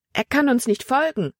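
high-pitched calling out; urgent call. A narrator's reading. Libby calls that he cannot follow, high urgent call. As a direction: call it up to someone ahead. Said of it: "rief Libby Emma zu".

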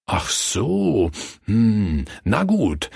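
pondering, with a drawn-out hm; thoughtful. A narrator's reading. Rider reconsidering after the robbers refuse, thoughtful. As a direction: think it over aloud. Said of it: "sagte der Reiter nachdenklich".

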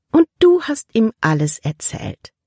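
syrupy innocent tone; sweet voice. A narrator's reading. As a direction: sweetly put question, starting high. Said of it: "immer noch mit süßer Stimme".

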